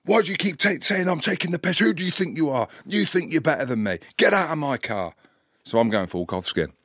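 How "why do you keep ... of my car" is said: very high, indignant imitation; fuming driver. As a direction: rant like an outraged driver, pitch way up. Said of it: "he's absolutely fuming".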